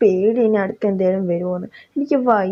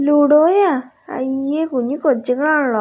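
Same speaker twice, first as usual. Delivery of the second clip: surprised; 5 semitones higher than usual. Acted. Shocked wife hears about ludo.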